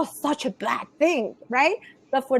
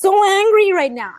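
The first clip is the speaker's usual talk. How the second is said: acted anger; 4 semitones higher, 5 dB louder than usual. Coach showing parents how to voice anger, emphatic venting. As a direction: let the anger out on "so". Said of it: "you feel your anger".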